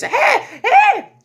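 very high, loud hey, hey; sudden excited shout; excited yelling. Real, not acted. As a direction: call out with a laugh. Excitedly calling out mid-story.

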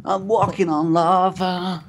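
high, slow hammed-up whoa; overplayed wonder, drawn out; mock amazement. Mocking friend walking on lava.

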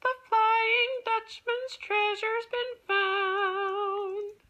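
high, slow mermaid voice; high sing-song mermaid call. Acted. Mermaid sings in the ocean.

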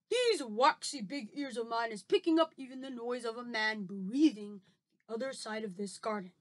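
lightly voiced friendly giant; a hint of a folksy giant; BFG voice. A narrator's reading. Reads the giant's lines.

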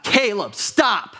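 mock scream to halt a child; retold scream. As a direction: call out an urgent warning, half-shouted. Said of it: "I screamed out".

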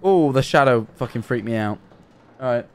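ordinary talk

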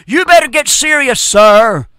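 stern rebuke. Real, not acted. Preacher warning an unsaved man at home, stern rebuke.

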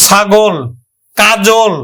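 ordinary delivery

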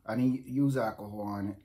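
ordinary delivery

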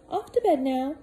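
high put-on motherly voice; cheery housekeeper. Missus Molasses sending Peter to bed, high cheery voice. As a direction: shoo him off to bed brightly.

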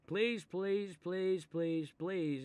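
mock pleading. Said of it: "that's what they always say".

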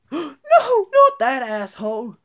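high, loud cry of protest; horrified shout. Acted. Student shouting at teacher about new classmate, high horrified yell. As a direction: yell in disgust at the new kid.

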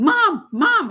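high, loud call of mom. Retold or imitated. Daughter urgently calling her mother, high and loud. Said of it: "my daughter's calling".

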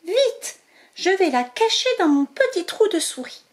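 high, hurried mouse voice; a small mouse rushing to hide her prize; tiny excited mouse; eager little mouse. Acted. Zazie speaking.

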